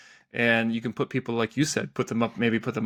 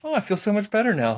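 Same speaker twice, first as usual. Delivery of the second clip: high, lifted mock-relieved voice; gushing relief; 10 semitones higher, 4 dB louder than usual. Coworkers cheered up by the bubbly arrival, gushing relief. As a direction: gush as if sunshine just walked in. Acted reply to the sunshine arriving.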